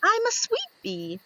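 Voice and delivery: a very high, cute little bee; tiny squeaky bee. Acted.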